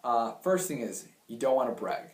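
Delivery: normal talking voice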